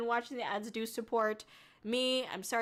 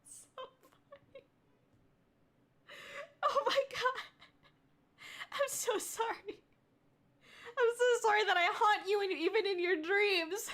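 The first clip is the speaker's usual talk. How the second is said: high, weak voice wheezing with laughter; real helpless laughter; 9 semitones higher than usual. Real, not acted. Streamer apologising for haunting a viewer's dreams, cracking up. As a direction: apologise while nearly crying from laughing. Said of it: "my cheeks hurt".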